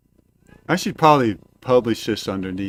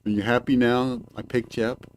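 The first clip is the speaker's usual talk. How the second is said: ordinary talk, nothing marked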